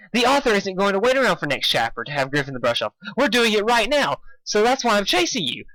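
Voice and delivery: put-on high, bubbly pony girl; chirpy pitch way up; Pinkie's voice. A narrator's reading. Narrator voicing Pinkie calling out to Dashy, high.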